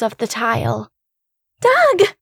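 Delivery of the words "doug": high, loud scream of a name. A narrator's reading. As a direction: scream his name in terror. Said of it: "I screamed".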